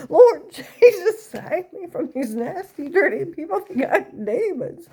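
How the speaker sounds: loud, high church lady tirade breaking into laughter; mock screaming mom. Mother screaming about stalkers, loud high tirade with laughter. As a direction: scream it like a hammed-up Southern Baptist lady, laughing. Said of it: "She would have been screaming".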